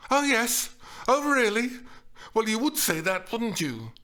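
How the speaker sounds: high, loud sarcastic retort, easing down; indignant sarcasm; scornful disbelief. A narrator's reading. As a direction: throw it back with mocking doubt. Acted quarrel, sarcastic disbelief.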